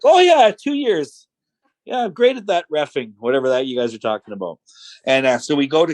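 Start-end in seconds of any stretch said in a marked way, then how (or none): 0.0-3.1 bluffing agreement. Retold or imitated. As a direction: play along with a big drawn "oh yeah". Bluffing along with false credentials.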